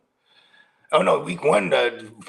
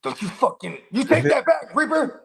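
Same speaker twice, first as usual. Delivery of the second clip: playful outrage; 12 semitones higher, 3 dB louder than usual. Real, not acted. Joking protest at chat.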